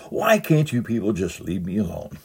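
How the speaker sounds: hammed-up wail of complaint; mock crying scream. Husband to a clerk offering help, mock tearful scream. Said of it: "he began crying and screamed".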